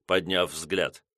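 ordinary delivery

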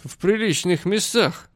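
tipsy and mocking; drunk voice. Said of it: "таким же пьяным голосом".

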